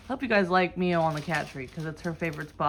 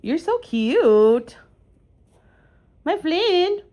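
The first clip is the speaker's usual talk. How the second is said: high, loud, drawn-out cooing; gushing pet talk; 10 semitones higher, 9 dB louder, much slower than usual. Real, not acted. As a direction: gush over a beloved pet, stretched right out.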